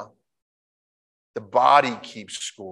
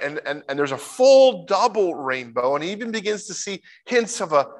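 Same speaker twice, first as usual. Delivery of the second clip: ordinary talk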